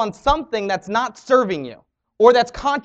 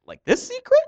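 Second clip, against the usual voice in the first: surprised wife. Retold or imitated. Wife holds up the product.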